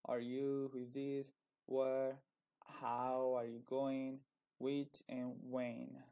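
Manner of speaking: each question word drawn out slowly; slow teaching read. Shown as a demonstration. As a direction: spell it out slowly for the students. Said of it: "Falei do who". (leitura lenta de professora)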